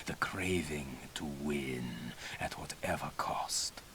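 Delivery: hushed, slow whisper, leaning in close; soft unvoiced whisper of a sly old teacher; conspiratorial whisper. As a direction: whisper it like a secret between mentor and pupil. Mentor confides secret challenge to pupil.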